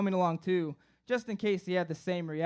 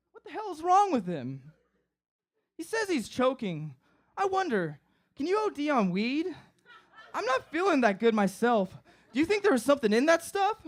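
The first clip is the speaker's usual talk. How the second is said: high-pitched worry; 6 semitones higher than usual. Retold or imitated. Roommate worried and high.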